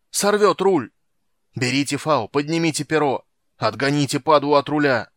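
high, loud frantic orders; bellowing. A narrator's reading. Captain orders crew to save the rudder, bellowing. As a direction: yell commands over the fight. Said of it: "орал Подуарак".

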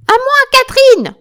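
very high, full-force scream for backup; screamed call. Acted. Wounded Joséphine summons Catherine, screaming at full force. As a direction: shriek for your comrade at the top of your voice. Retake, hurt woman calls for help.

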